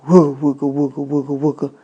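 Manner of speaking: low soft imitated engine wooga; engine grumble. A narrator's reading. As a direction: rumble it like an idling car engine. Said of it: "It was saying".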